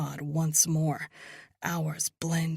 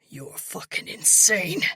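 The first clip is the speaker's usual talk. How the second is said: gasping; 3 semitones higher, 5 dB louder than usual. A narrator's reading. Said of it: "he gasps".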